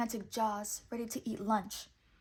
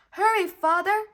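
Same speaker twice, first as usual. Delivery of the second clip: loud high urgent yell; 9 semitones higher, 12 dB louder than usual. A narrator's reading. Pinocchio hurrying his father on the raft, loud high yell. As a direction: urge him on with a shout. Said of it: "yelled Pinocchio".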